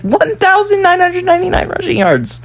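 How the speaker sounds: thrilled at the near-record stat; amazed excitement. Real, not acted. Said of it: "We can't be serious".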